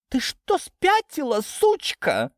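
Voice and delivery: very high-pitched angry outburst; outraged. A narrator's reading. Lidia about to slap Natasha, outraged. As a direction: screech it in outrage. Mother attacking daughter in quarrel.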